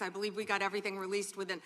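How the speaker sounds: rushed, rapid delivery; talking fast. Real, not acted. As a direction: hurry to beat the clock. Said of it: "I'm talking as fast as I can".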